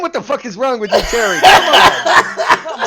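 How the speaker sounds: loud, laughing fake indignation; mock outrage. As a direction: playfully yell at your friend. Friend mock-outraged over game taste.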